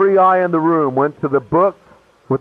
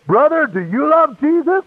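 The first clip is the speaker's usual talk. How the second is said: high-pitched, challenging preacher cry; mock fervent preacher; 11 semitones higher than usual. Preacher turns on a student in the room, mock fervent challenge. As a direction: imitate a preacher singling out one listener. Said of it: "he turns to me and he goes".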